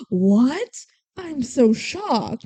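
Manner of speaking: drawn-out mock shock, slow and overdone; sarcastic. He pretends to be shocked at her confession, sarcastic. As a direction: feign shock, stretching it out slowly. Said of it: "He's being sarcastic".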